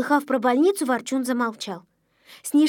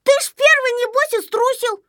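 high, raised-voice retort; accusing; 11 semitones higher, 4 dB louder than usual. Acted. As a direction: throw the blame back in a high voice. Accusation in a story quarrel. (обвиняя, высоким голосом)

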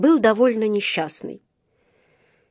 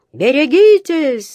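high, loud, drawn-out warning shout; yelled warning; 7 semitones higher, 5 dB louder, slower than usual. A narrator's reading. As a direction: bellow a warning to the crowd. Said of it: "заорал мастер".

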